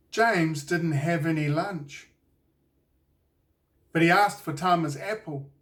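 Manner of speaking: ordinary delivery, nothing marked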